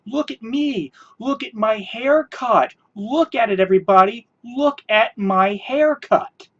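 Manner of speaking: sing-song look at me, drawn out on the last line; mocking vain show-off. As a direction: ham up a vain vlogger. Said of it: "but you're like".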